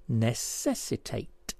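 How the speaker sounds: "necessitate" slowly, with NESS set apart; syllable by syllable. Shown as a demonstration. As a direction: break "necessitate" into slow separate syllables. Puzzle answer shown by syllables.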